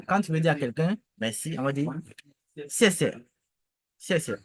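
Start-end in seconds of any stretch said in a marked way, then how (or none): 2.7-4.3 short falling tone on each SIE; fourth-tone demo. Shown as a demonstration. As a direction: model SIE SIE with dropping tones. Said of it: "les quatrièmes tons".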